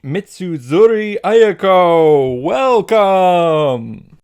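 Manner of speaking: loud, high announcer's welcome; hammed-up introduction. Introducing a schoolmate to the audience, hammed-up announcement. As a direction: belt out the name like a host.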